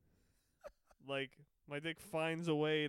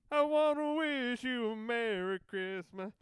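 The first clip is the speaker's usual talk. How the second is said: goofy slow greeting voice; 8 semitones higher, 7 dB louder than usual. Pretend. Put-on holiday greeting.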